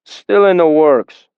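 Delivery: slow, dismissive gruff voice; curt bald man. A narrator's reading. Bald sailor turning his shoulder, slow and dismissive. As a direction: brush him off without interest.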